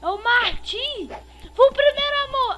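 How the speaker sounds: high, loud excited call; excited shout. Real, not acted. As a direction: yell it out, thrilled. Shouting the killer's name.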